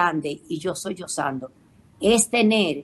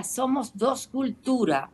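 normal talking voice

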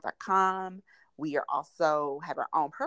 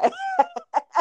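embarrassed high laugh; laughing at herself; 8 dB louder than usual. Real, not acted.

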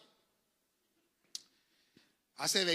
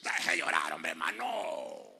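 gruff mock scolding; 13 semitones lower, slower than usual. Regaña al quejoso imitado.

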